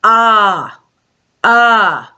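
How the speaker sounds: "Ah, ah" as a short open uh sound. Shown as a demonstration. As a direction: say "Ah" as a clipped uh, twice. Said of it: "U says".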